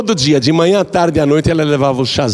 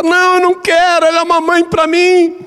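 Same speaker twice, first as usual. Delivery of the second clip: very high, pleading, near-tearful begging; desperate remorse; 3 dB louder than usual. Acted. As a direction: beg the wise man for the antidote. (nora desesperada implorando, voz bem aguda)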